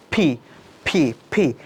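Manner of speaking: "ফি" repeated with a strong puff; aspirated P. Shown as a demonstration. Teacher drilling students to try it, puffed aspirated "ফি". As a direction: force air out on each separate "ফি". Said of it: "এটাকে বলা হয় aspirated sound".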